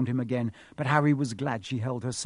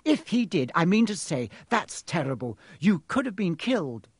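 high-starting indignant outburst; exploding indignantly; 6 semitones higher, 4 dB louder than usual. A narrator's reading. Hermione scolds over the danger to Harry, indignant. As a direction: protest in a mild explosion, stumbling into it. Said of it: "Hermione exploded".

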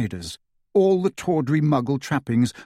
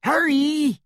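high urgent call from below; 13 semitones higher than usual. A narrator's reading. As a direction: call out his name in alarm. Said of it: "he heard Hermione call".

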